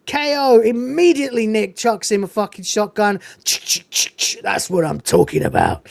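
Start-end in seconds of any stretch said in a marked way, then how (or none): 3.4-4.4 unvoiced-sounding "ch-ch" gun noise; imitated shotgun sound. Narrator acts out Nick chucking him a shotgun, imitated gun clacks. 4.4-5.9 low-pitched imitation of an action-film one-liner; Nicholas Angel. Narrator quotes Angel getting a shotgun, low put-on one-liner. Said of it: "suddenly he's talking like this".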